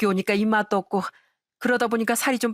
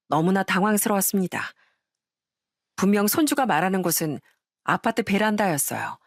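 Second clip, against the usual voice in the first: ordinary delivery, nothing marked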